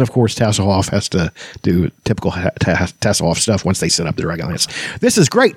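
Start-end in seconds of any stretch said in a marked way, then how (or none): 5.0-5.6 excited kender. A narrator's reading. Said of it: "Taz said, experimenting".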